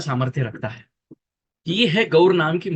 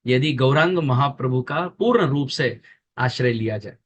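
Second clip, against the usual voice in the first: normal talking voice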